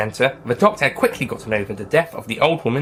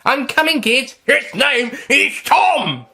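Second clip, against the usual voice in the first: high, loud shouts; yelling; 13 semitones higher, 5 dB louder, much slower than usual. Acted. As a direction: call across the street. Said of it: "Tom's father yelled".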